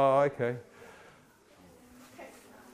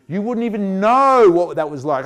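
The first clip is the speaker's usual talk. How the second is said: ordinary talk, nothing marked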